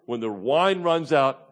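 usual speaking voice

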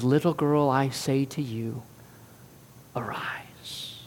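gentle, fading to a whisper; tender. Retold or imitated. Jesus speaks to the little girl, tender and hushed. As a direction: speak softly, whisper the last word. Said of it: "The tenderness of our Lord".

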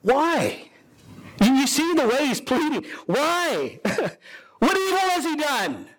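high, pleading questions with a nervous laugh; Pilate pleading. Acted. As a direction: beg the crowd to see reason, pitched high. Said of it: "Pilate says to the people".

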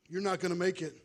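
loud, jeering devil voice; loud devil taunt. Acted. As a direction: call it out like a tempting devil. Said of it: "You start to hear things like".

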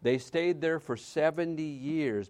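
normal talking voice

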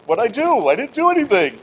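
high-pitched, defensive protest; mock-innocent squeal of denial; baffled protest. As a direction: act out a wrongly accused spouse. Voicing the baffled accused spouse.

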